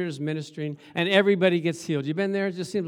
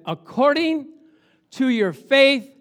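loud, raised, drawn-out declaration; solemn pronouncement; 7 semitones higher, 8 dB louder, slower than usual. Acted. Jesus healing the blind men, loud and declaratory. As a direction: proclaim it slowly and forcefully.

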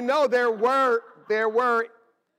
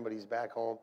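usual speaking voice

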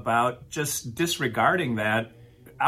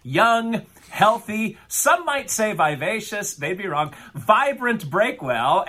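upbeat show host intro; hosting voice, bright and announcing; 6 semitones higher, 4 dB louder, slower than usual. Acted.

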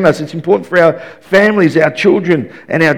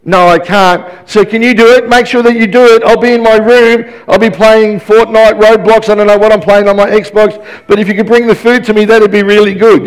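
lightly voiced nagging boy, pitched up; [name]; 5 semitones higher, 4 dB louder than usual. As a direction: keep pestering like a kid, higher and insistent. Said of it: "they just keep asking and asking".

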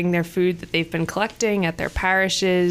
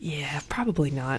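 whispered aside; 4 semitones lower, 7 dB quieter than usual. Real, not acted. Quiet aside to co-host.

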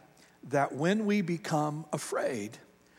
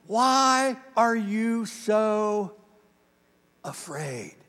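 calm Jesus; 3 semitones higher, much slower than usual. Retold or imitated. Jesus calms disciples in storm.

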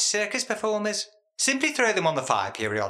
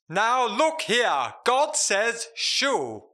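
slow, grand pronouncement, higher pitch; religious proclamation; 5 semitones higher, much slower than usual. Shown as a demonstration. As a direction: proclaim it like a stately preacher. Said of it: "The announcement usually has a religious leaning".

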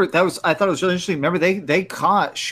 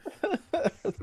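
laughing high and soft; squeaky laugh; high-pitched laugh; 11 dB quieter than usual. Real, not acted. Laughing at raccoon joke.

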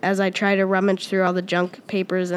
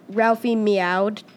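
usual speaking voice

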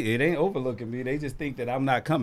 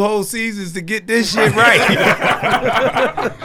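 loud, slow laughing jab; laughing tease; 8 semitones higher, 8 dB louder, slower than usual. Real, not acted. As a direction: rib them while cracking up. Laughing teasing the hosts.

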